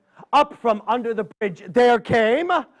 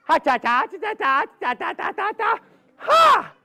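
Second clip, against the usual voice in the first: high, rapid-fire squirrel jabber; squirrel chatter; 9 semitones higher than usual. Acted. Hungry squirrel sitting at the table, high chattering. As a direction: chatter on one syllable, ending on a high yelp.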